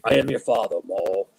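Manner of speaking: low, lightly hammed-up villain voice; playful impression of a dark lord; Star Wars villain. Quoting Star Wars villain in jest.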